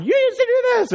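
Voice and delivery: mock a bird re-enacting an argument in a high voice; yelling parrot. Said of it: "He'll get involved and start yelling".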